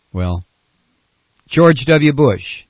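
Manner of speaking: ordinary talk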